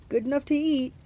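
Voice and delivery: lightly voiced hungry Cyclops; hungry monster. A narrator's reading. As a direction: savour it as the monster. Cyclops answers Grover.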